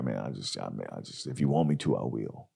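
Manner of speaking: low, very soft embarrassed mumble; sheepish limo driver. Retold or imitated. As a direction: reluctantly give in. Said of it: "He's like".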